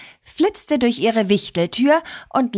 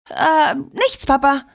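put-on higher, hesitant child's denial; embarrassed; 6 semitones higher than usual. A narrator's reading. Liliana hides the note from dad, embarrassed. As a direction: cover up awkwardly with a little stall. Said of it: "sagte sie verlegen".

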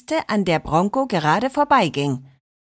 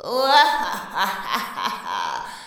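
a creepy cellar-ghost chuckle; eerie ghost laugh. Acted. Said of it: "einem schaurigen".

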